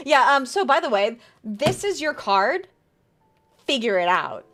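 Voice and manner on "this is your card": normal talking voice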